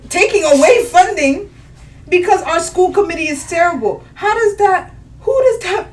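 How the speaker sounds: can't believe how unfair this is; outraged rant. Real, not acted. Outraged rant about funding.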